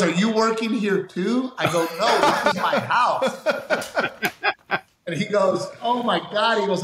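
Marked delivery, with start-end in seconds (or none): 0.0-1.5 imitated amazed contractor, much higher pitch; surprised question. Contractor asks comedian if he works there, surprised. 2.0-3.4 retold protest, pitched way up; high, incredulous correction with a laugh in it; amused protest. Homeowner corrects the contractor who took him for a worker, protesting. 5.8-6.6 retold amazement, pitched much higher; astonished realisation. Contractor realises whose house it is, astonished.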